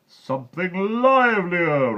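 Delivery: loud, slow, high giant's demand; slow booming order. A narrator's reading. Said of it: "ordered the giant".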